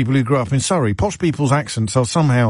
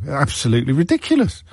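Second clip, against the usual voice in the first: ordinary talk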